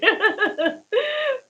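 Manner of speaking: high-pitched laugh that nearly breaks; high helpless laugh. Real, not acted. Laughing at the broken connection.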